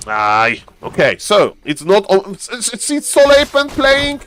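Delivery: usual speaking voice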